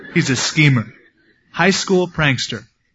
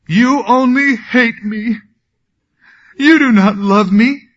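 high, whiny mock-weeping plea, pitched well up; a hammed-up, tearful nagging wife; fake weeping wife; 8 semitones higher, 6 dB louder than usual. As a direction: ham up a wife's put-on tears, louder. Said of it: "and this is all fake".

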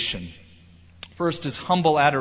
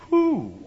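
relieved whew; 13 semitones higher than usual. Real, not acted. Relief after thanks for no.

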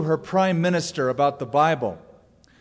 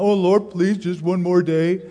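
higher, louder hammed-up begging; mock pleading; 4 semitones higher, 4 dB louder than usual. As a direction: beg as if bargaining for time, in jest. Mock plea to delay heaven.

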